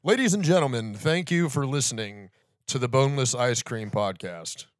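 loud booming radio announcer; radio host voice. Pretend. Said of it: "give us your radio thing".

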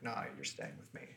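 low, soft, quick menacing voice; sinister dark lord. Pretend. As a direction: murmur it like a possessive dark lord. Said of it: "he's like".

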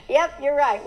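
said through a laugh; sheepish laughing yes; laughing admission. Retold or imitated. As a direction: admit it laughing at yourself. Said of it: "And I was like".

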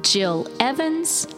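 ordinary talk, nothing marked

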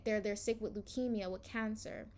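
normal talking voice

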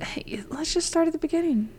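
quick whispered aside. Real, not acted. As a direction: whisper it off-mic to your co-host. Aside to co-host before starting.

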